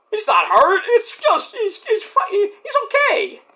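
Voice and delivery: mock soothing mom. Mother calming kids over bloody dad, high sing-song. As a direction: talk the kids down, all bright.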